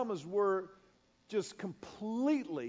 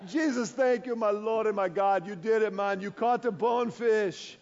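joyful worship; 2 semitones higher, 6 dB louder than usual. Retold or imitated. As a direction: cry out thanks in delight. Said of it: "my guide begins worshiping there on the flats".

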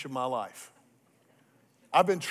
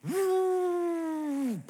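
a long, very high sustained "I"; long high held note; 13 semitones higher than usual. Acted. Pastor reliving the moment the tarpon struck, high held note. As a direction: hang on the word in suspense. Holds breath as fish rises.

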